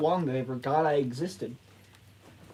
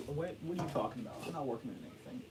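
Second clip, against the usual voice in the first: ordinary talk, nothing marked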